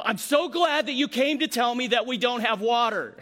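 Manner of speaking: loud, dripping mock gratitude; sarcastic reply. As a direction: lean hard on glad, all sarcasm. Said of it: "You know".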